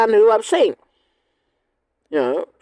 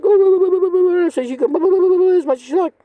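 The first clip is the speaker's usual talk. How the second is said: mock dismissive chatter; 7 semitones higher than usual. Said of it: "she's like".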